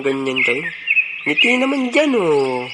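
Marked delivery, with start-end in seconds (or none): 1.2-2.7 high, drawn-out coaxing; pitched-up, playful plea to friends; playful cheering-up. Acted. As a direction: coax gloomy friends in a high singsong.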